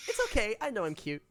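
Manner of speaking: smarmy queen. Acted. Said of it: "that smarmy, snotty, uh, s-, voice".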